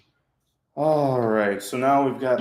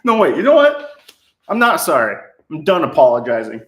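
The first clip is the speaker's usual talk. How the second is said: loud mock defiance; 3 semitones higher, 8 dB louder than usual. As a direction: loudly take back the apology, hammed up. Jokingly retracts apology.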